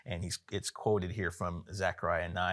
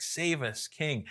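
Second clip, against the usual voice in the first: higher-pitched but not shouted cry; cry of praise; 6 semitones higher, slower than usual. Retold or imitated. Preacher voicing the crowd hailing Jesus, mild cry of praise. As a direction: lightly imitate a crowd's praise. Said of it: "it's like this cry of praise".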